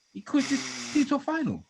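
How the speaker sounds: very high, squeaky repeat; comic high-pitched disbelief; mock high voice. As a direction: pitch it right up in mock amazement. Mock incredulous repeat of own line.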